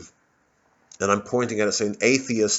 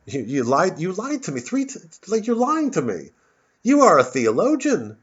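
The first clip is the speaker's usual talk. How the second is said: much higher, rising outrage; indignant accusation; 8 semitones higher, 3 dB louder than usual. Retold or imitated. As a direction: accuse the vicar of lying, voice climbing. Confronting the vicar in retelling.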